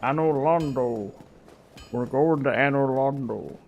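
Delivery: slow, drawn-out serpent voice; lightly voiced ancient serpent; Great Serpent's voice. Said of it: "I'm trying to do, um, the Great Serpent's voice".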